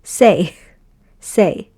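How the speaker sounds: teacher blending say for the class; blended syllable say. Shown as a demonstration. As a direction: say it out proud. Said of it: "and you say".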